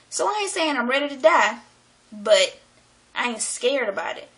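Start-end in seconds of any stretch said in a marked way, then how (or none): none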